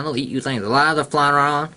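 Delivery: usual speaking voice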